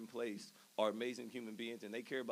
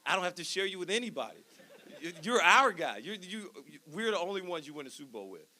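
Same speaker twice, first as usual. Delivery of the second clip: higher, louder excited friend with a laugh; friend's quote; 7 semitones higher, 7 dB louder than usual. Retold or imitated. Friend explains on phone, lightly voiced excited. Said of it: "He said".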